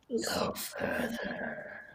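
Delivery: slow, drawn-out creature whisper. Acted. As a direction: breathe it out slowly, like a warning. GM voices a guardian creature.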